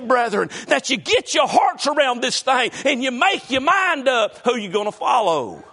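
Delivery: preaching hard to the congregation; sermon delivered with full fervour. Acted. Preacher driving the point home to his church.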